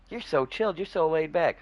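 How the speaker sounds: way up high, singsong praise; recapping the story, high and mocking; high mock compliment. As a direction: replay the compliment pitched sky high. Mocking his own compliment.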